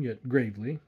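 usual speaking voice

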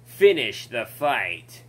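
slow, heavy soldier line. Retold or imitated. Chief answering the pilot, slow and stern. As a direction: growl it out slowly like a grim super soldier.

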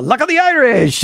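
cliché put-on Irish brogue; mock Irish accent. Teller showing Irish clichés, mock Irish accent. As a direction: imitate a stock Irish accent. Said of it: "those accents are real".

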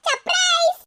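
high, slow, drawn-out announcement of a surprise; excited surprise. Acted. Mother-in-law springs a birthday surprise on daughter-in-law, high and drawn out. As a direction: sing it out like springing a party.

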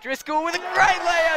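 excited call. Real, not acted. Commentator reacts to Driscoll's layout for viewers, excited shout. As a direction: burst out as the layout happens. Big defensive layout play.

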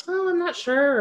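lightly voiced wavering reader; unsure fan. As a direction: imitate a doubtful fan at a signing. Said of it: "But if they would say".

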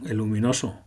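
usual speaking voice

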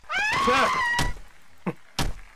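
sudden high scream into a laugh; startled shriek-laugh. Real, not acted. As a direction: shriek from the scare. Jump scare from trees.

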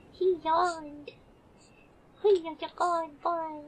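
delighted coo. Real, not acted. Telling chat her dog yawned, high delighted coo. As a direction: gush over the dog's yawn. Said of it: "did you hear that".

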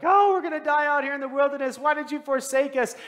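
loud, high mock panic wail; up in arms. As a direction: cry out in hammed-up alarm. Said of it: "everybody's already up in arms".

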